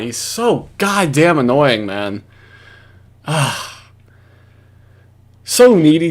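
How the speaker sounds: ordinary delivery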